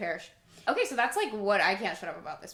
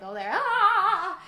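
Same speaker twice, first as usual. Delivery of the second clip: mock wince of pain; 10 semitones higher, 6 dB louder, much slower than usual. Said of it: "and I'm like".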